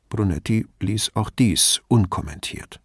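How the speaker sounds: usual speaking voice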